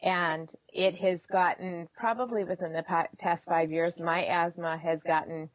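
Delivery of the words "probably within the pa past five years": ordinary talk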